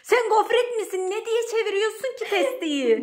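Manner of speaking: high, loud exasperated rebuke; loud scolding. Real, not acted. Owner scolding housemate for turning the jug, exasperated shout. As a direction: scold the culprit at full pitch.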